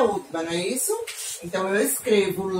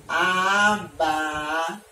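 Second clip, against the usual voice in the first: "abaca-" slowly, each syllable drawn out and left unfinished; 5 semitones lower, much slower than usual. Shown as a demonstration. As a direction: stretch out "abaca-" as if dictating a blank to fill. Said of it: "que tava assim". ("abaca-" devagar, sílaba por sílaba)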